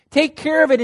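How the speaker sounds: now hammer it home with force; forceful repeat. Acted. Pastor pressing the congregation, loud and emphatic.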